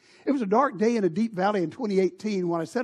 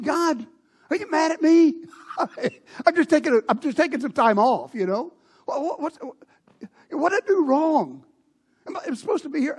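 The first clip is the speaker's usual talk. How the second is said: anguished plea; 6 semitones higher, 4 dB louder than usual. Retold or imitated. A pleading cry to God.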